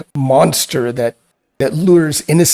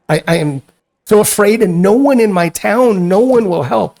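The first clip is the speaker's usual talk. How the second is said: afraid; 6 semitones higher, 5 dB louder than usual. Acted. Frightened sister pleads for help.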